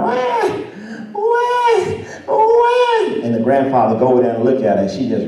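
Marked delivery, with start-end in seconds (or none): 0.0-3.2 very high-pitched imitation baby wail; mimicked infant cry, pitched way up. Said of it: "And that baby crying".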